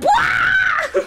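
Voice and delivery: high cartoonish wail; over-the-top wail like losing it on stream; high wailing shout. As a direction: let out a shrill mock wail. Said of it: "I'm like".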